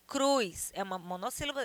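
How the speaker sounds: usual speaking voice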